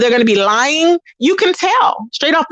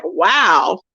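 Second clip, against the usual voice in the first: baffled disbelief; 8 semitones higher than usual. Retold or imitated. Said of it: "I'm just like".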